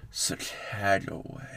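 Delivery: slow breathy sultry whisper; sultry narrator. Pretend. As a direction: whisper it slowly, seductively. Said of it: "One more time, but slower?".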